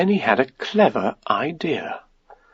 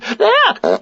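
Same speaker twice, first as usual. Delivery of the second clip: very high squeak; frightened Piglet. A narrator's reading. Piglet hearing Pooh bumping, frightened. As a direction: squeak it in alarm. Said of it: "said Piglet".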